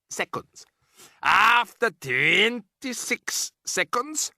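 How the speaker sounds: high, slow witch voice; a sinister foreign witch boss; Grand High Witch. Acted.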